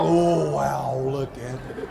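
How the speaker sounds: low, hushed gasp of wonder; awed prince. Acted. Prince sees Cinderella arrive.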